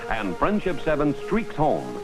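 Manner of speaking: ordinary talk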